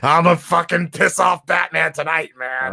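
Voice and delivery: lightly voiced spaced-out villain; a hint of a stoned Joker. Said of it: "L S D Joker or whatever".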